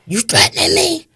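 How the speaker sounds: high, sharp rising challenge, imitating him; mock indignant killer. Killer confronting the mother at the car window, high mock indignation. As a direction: throw the question back at her with fake outrage. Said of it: "He says to me".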